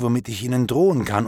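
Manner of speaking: usual speaking voice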